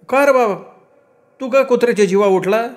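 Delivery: very high-pitched village mother; reproachful mother. A narrator's reading. Mother asking why her son turned on the dog, reproachful. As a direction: question him reproachfully, voice pitched right up.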